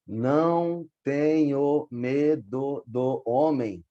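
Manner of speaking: "Não tenho medo do homem" split slowly into its grammatical syllables; slow, spaced-out syllable count. Shown as a demonstration. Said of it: "se a gente for dividir as sílabas dele de maneira gramatical".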